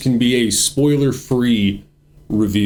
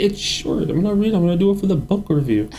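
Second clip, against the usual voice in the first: high defensive protest; 9 semitones higher than usual. Real, not acted. Defending reading the book.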